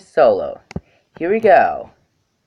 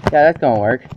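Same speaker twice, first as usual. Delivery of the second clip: ordinary talk, nothing marked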